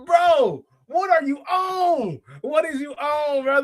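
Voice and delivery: high, slow, disbelieving; real incredulous disbelief. Real, not acted. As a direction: drag it out in disbelief at a wild claim. Reacting to chat's wild claim.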